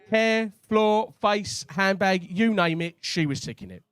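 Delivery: ordinary talk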